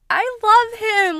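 high, slow swooning gush. Real, not acted. Fan gushing about her favorite character, high and slow. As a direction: swoon over him out loud.

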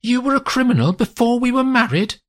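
almost yell it at him; nearly shouting. A narrator's reading. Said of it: "she is nearly shouting".

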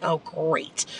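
deadpan oh great to yourself; sarcastic thought. Retold or imitated. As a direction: roll your eyes and say it under your breath. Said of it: "I'm thinking".

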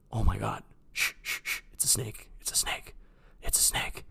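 play-acted scared whisper; secretive, barely voiced warning; hushed alarm. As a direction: whisper it as if something lurks nearby. Acts out hushed snake scare prank.

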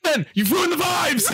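high, drawn-out retold yell; mock yelling. Upset gambler yells at Ben over ruined vibes, mock outrage. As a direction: cry out slowly in hammed-up outrage. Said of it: "You shouldn't be yelling".

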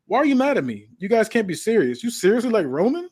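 high, whiny mock hurt at the crowd; mocking heel taunt. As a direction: play the wounded heel, needling them.

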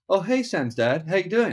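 chirpy schoolkid saying hello; imitating a cheery kid. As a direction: put on a bright little kid greeting. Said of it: "and they're like".

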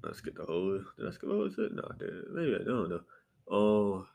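usual speaking voice

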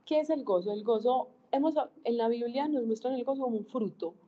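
normal talking voice

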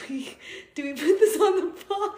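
laughing. Retold or imitated. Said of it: "I'm like".

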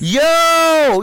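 high, loud exclamation; amazed yo. Real, not acted. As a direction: react in disbelief. Amazed at sister.

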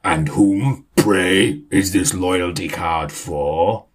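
slow, haughty grand lady; lofty slow voice. Acted. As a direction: draw it out grandly. Lady's lofty voice continues.

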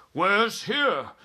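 loud, high, baffled question; alarmed cry. A narrator's reading. Army head shown a blank page, alarmed cry. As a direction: burst out with a bewildered cry. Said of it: "cried the head of the army".

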